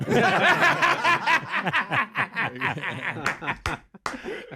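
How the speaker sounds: high, helpless belly laugh; long hearty laugh. Real, not acted. Storyteller cracking up at his coach story, hearty laughter. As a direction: burst out laughing and keep it going.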